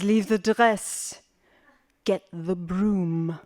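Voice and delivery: "Leave the dress" and "Get the broom" said slowly, word by word; each word weighed, calm and firm; slow firm command. Retold or imitated. Mother orders her daughter.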